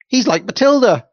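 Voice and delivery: put-on high, loud giggly children's shout; children teasing, delighted; mocking kids' cry. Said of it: "they cried".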